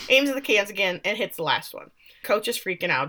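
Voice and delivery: ordinary talk, nothing marked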